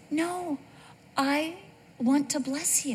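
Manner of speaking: a hint of a gentle God. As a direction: reassure gently. God voiced softly.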